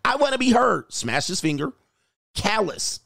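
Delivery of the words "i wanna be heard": higher, mock-insistent quote; retold insistent outburst. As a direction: lightly mimic someone demanding attention. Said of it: "She had to say what she had to say".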